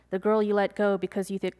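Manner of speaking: ordinary delivery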